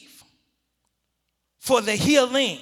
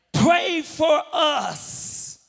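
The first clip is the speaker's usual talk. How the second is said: crying out; 5 semitones higher, much slower than usual. Acted. Father pleading with Jesus for his son, crying out. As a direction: stretch it out as a desperate cry. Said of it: "The Father cries out".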